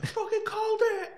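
high laughing brag. Real, not acted. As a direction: crow it through a laugh. Laughing boast after story.

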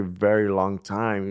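ordinary talk

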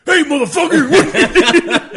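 very loud, high drawn-out holler; mock scream. Shown as a demonstration. Hunter showing how he'd scare the passerby, mock scream. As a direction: scream it as a prank, breaking into laughter. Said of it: "just scream at him".

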